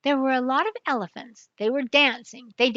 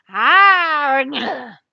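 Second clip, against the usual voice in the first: loud mock wail; imitated cry; 3 semitones higher, 10 dB louder than usual. Detective imitates the strange jungle cry, loud mock wail. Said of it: "They do not say".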